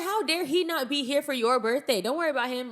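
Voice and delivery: hammed-up defence against grandfather; mock indignant. Granddaughter mockingly scolds absent husband to grandfather. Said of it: "I'm like".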